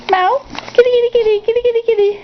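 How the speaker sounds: very high, rapid-fire kitty call; calling the cat. Real, not acted.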